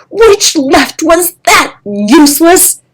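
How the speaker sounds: loud, high, slow scornful bellow. Acted. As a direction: yell the insult right in his ear. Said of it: "Kestrel bellowed in his ear".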